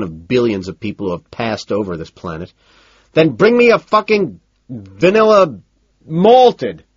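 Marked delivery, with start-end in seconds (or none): 3.1-6.8 loud, high roar of fury; angry roar. A narrator's reading. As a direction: roar the order at the waitress. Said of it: "I roar".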